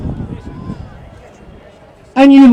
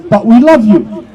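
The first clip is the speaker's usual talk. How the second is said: a sneering "but we love you", pitched lower; sarcastic love; 8 semitones lower than usual. Retold or imitated. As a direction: throw their hollow words back at them, mocking. Mocking the authorities' fake care again.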